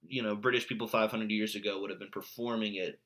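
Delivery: ordinary talk, nothing marked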